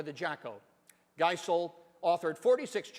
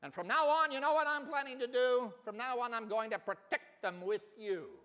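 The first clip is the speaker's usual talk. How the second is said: higher, earnest promise; kangaroo vowing; 6 semitones higher than usual. Acted. Big kangaroo promising Horton to protect the Whos, earnest. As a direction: pledge it proudly.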